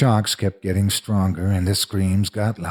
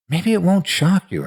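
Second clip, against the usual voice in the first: anxious hoping; 10 semitones higher, 3 dB louder than usual. A narrator's reading. Frightened child bargaining before shock.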